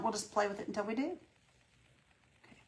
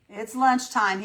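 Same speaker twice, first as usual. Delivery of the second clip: louder; 4 semitones higher, 8 dB louder, much slower than usual. Real, not acted.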